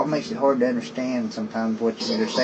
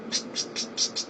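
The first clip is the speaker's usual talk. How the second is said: unvoiced hissing psst sounds; lisped hiss; 8 dB quieter, much faster than usual. Shown as a demonstration. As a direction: hiss air through a missing front tooth. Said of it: "I sound like an old drunk".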